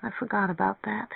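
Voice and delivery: soft, high, drooping little voice; mournful. A narrator's reading. Mary Ann realises she cannot pay, mournful. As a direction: say it small and defeated. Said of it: "she said mournfully".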